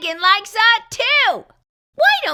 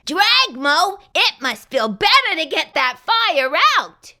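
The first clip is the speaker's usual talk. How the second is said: plain reading voice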